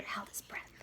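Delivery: hushed breathy narration; storyteller whispering as the boy hides; whispered suspense. A narrator's reading. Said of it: "narration whispered for suspense".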